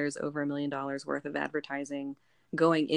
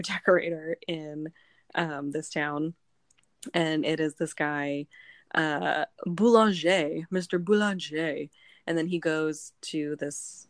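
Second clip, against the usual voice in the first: ordinary delivery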